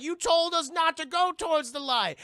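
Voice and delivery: loud, high imitated protest; protesting father. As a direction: shout the objection as the dad.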